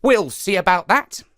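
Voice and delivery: high, sly spider voice; lively trickster spider, eager and sly; Anansi the trickster. Acted. Anansi retort.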